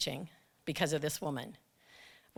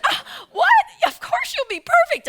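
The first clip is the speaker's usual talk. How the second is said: high-pitched, pushy, overeager shout; shrill fake-sweet office bully; eager coworker; 10 dB louder than usual. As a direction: squeal it like a pushy coworker volunteering someone. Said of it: "And she said".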